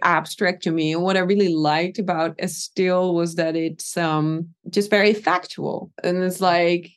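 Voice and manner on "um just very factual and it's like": usual speaking voice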